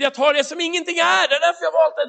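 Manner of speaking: high, loud, insistent answer; emphatic retort. Acted. God answering the self-doubting pastor, emphatic retort. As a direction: answer back forcefully, pitched high.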